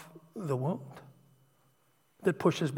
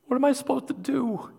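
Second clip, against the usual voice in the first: retold plea to God, climbing high; begging; 9 semitones higher, 3 dB louder than usual. Man on his knees asking God for direction, pleading. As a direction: cry out upward, imitating a desperate prayer. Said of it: "started begging God to show me".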